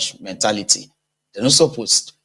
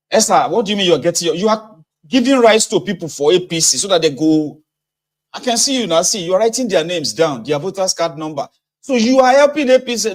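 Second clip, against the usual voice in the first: much higher, worked-up accusation; accusing voter; 7 semitones higher, 3 dB louder than usual. Retold or imitated. Voter exposing the party agent, high and agitated. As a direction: catch him in the act, loudly. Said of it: "reply in skit".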